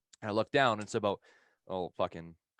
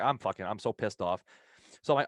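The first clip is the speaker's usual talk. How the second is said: ordinary talk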